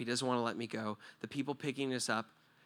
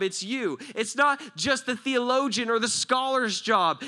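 high, loud, driving preacher's call; preaching at full force; 10 semitones higher, 9 dB louder than usual. Acted. Preacher urging the church to stop being victims, full-force preaching. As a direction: rouse the congregation to stand up and act.

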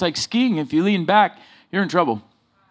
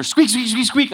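higher, hurried mock squeak while fleeing the dog; frantic squeaking; 7 semitones higher than usual. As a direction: squeak it out in panic as the bike speeds up. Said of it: "I'm calling him even more".

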